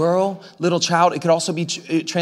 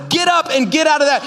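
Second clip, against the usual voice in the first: commanding shout; 8 semitones higher than usual. Acted. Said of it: "He looks at Joshua and says".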